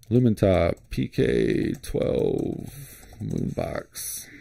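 absent-minded, stretched-out search-term mumble; typing aloud. Real, not acted. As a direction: say it slowly as you type each word. Reading aloud while typing a search.

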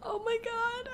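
high squeal of laughter into oh my god; high laughing disbelief. Real, not acted. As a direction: laugh helplessly, pitch shooting up. Laughing at own blunder.

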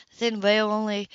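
usual speaking voice

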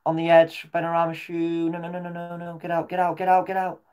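high, urgent pleading; will the ball away in panic; tense real shouting. Real, not acted. Fan urging his defenders to clear, high tense pleading.

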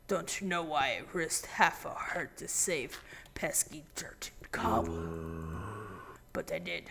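low, hushed, hammed-up villain monologue; lightly voiced brooding overlord taunting a cave; mock-grand whispered drama; dramatic voice. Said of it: "in dramatic voice".

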